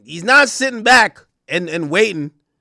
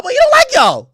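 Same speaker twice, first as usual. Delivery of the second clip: high emphatic; 5 dB louder than usual. Real, not acted. Telling the media he doesn't like them, high and loud. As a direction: pitch it way up, loud. Ranting about [name]'s critics.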